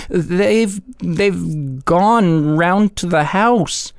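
slow, hesitant other voice; stumble, then drag it out; stammering voice. A narrator's reading. Answer to the popcorn man.

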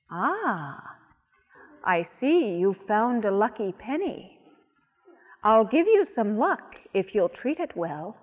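lightly voiced little old woman. A narrator's reading. Old woman speaks to Laura.

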